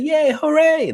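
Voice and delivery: put-on very high, loud squealing toddler cheer; excited toddler. Little son cheering over his worm treat, high squeal. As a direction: squeal like a thrilled two-year-old.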